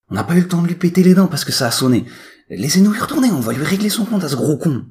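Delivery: high, loud, fast threat; high-pitched hothead itching to fight; riled-up twin. A narrator's reading. Willy's boast, acted in story.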